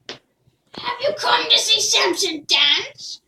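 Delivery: put-on slow, high, loud old fortune-teller voice.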